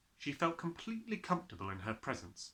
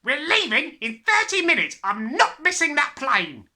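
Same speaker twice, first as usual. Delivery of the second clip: snapped; 15 dB louder than usual. Acted. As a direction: snap at the child impatiently. The father's voice.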